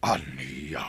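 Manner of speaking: stunned whisper; whispered disbelief; whispered shock. Acted. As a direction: breathe the question in disbelief. Stunned by son's betrayal.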